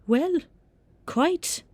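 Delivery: very high, loud Scottish professor; high reedy Scottish reply, with a pause between words; reedy Scottish accent. A narrator's reading. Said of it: "in a reedy Scottish accent".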